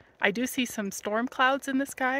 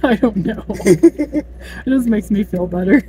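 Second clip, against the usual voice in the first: loud, tense nervous laughing; nervous laughter; 9 dB louder than usual. Real, not acted. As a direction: laugh through real fear on a cliff road. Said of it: "you were getting so freaked out".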